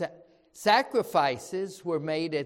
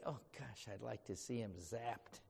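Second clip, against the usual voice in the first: muttered; 6 semitones lower, 14 dB quieter than usual. Retold or imitated. Mock grudge muttered under breath.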